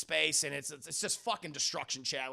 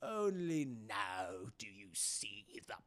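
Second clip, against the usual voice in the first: Emperor; 8 semitones lower, 4 dB louder, slower than usual. Retold or imitated. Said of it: "He's like".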